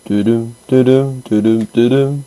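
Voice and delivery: sung jingle. Pretend. Mimics game jingle.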